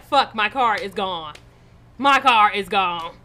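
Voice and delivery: loud, slow lament; retold screaming. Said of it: "I'm screaming about my car".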